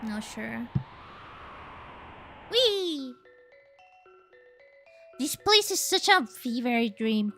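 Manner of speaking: ordinary talk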